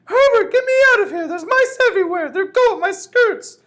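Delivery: piercing, hysterical wife screaming for rescue; shrill screaming. A narrator's reading. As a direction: scream very high and loud, terrified of mice. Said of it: "Missus Jenkins' shrill voice".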